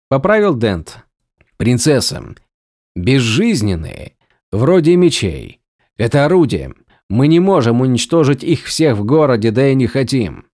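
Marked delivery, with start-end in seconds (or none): none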